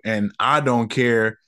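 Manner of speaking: usual speaking voice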